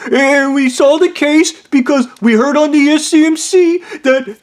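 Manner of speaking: high breathless whine; mock high panicked whine; whiny confession. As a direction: babble like a guilty kid.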